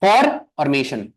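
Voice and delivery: "for" और "मेशन" as two separate slow chunks; split into syllables. Shown as a demonstration. Teacher shows students two syllables in formation, slowly split. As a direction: break formation into "for", pause, "मेशन". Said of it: "दो syllables हैं".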